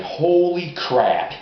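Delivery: slow, loud, high holy crap; stunned surprise. Retold or imitated. Fan reacts to news of the Iron Sheik, slow and stunned. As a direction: drag it out in disbelief.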